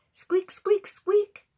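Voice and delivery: high, loud squeaks; chiming pail squeak. A narrator's reading. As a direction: chime it like a swinging pail. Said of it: "chimed the pail".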